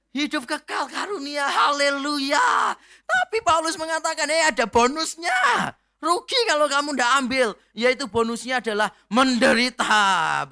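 fiery preacher's shout to the congregation; preaching at full force. Acted. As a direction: thunder it from the pulpit. Preacher building to a shouted climax.

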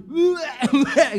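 big burst of real laughter; loud laugh. Real, not acted. Preacher laughs at own joke. (tertawa lepas keras)

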